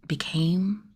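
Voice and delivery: softly fix the word; hushed aside; whispered correction. A narrator's reading. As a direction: whisper the correction under the breath. Self-correcting tense.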